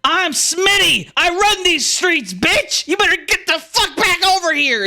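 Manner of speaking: a tiny thug puffing himself up; squeaky bandit yelling. Acted. Smitty yells at the player walking away, squeaky high.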